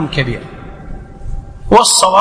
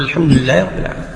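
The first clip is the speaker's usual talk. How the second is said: low, fast echo in the imam's pause; hurried, lower repetition; follower repeating quickly; 6 semitones lower, 4 dB quieter, faster than usual. Shown as a demonstration. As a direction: slip the verse in quickly after the imam. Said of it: "في سكتات".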